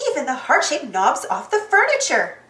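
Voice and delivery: ordinary delivery, nothing marked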